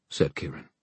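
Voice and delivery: ordinary delivery, nothing marked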